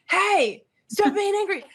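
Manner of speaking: hammed-up scolding shout; imitated yelling. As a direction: yell it like someone nagging in your ear. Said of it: "someone else yelling beside me".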